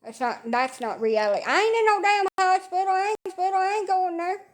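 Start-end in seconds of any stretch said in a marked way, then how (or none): 1.5-4.4 emphatic high denial. Real, not acted. To viewers, refusing the hospital, high insistent denial.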